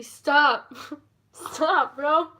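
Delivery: mock telling-off, punched loud; playful self-scolding. Real, not acted. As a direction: burst out at yourself, laughing between words. Scolds self for getting emotional.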